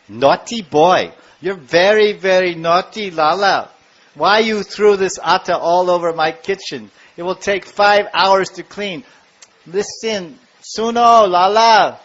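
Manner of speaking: exasperated mother's telling-off; scolding mother. Acted. Acted mother scolding naughty Krishna.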